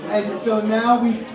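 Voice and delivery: usual speaking voice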